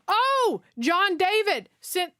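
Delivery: high sudden realization; surprised. Real, not acted. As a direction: catch it with surprise. Surprised by the sender.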